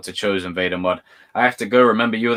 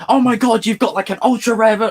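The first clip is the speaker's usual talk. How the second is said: excited fan; 12 semitones higher, 3 dB louder than usual. Retold or imitated. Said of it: "a Yu-Gi-Oh fan that would come along and be like".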